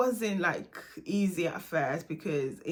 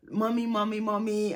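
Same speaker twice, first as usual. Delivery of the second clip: lightly voiced child whining for mom, a bit louder; a hint of a needy kid calling over and over; child calling mommy; 3 dB louder than usual.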